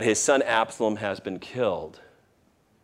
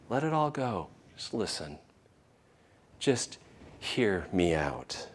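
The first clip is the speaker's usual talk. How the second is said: tender plea; 4 dB quieter than usual. Pretend. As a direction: coax a frightened friend gently. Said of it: "Jesus to just say your name".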